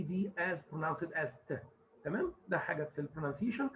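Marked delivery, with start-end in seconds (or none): none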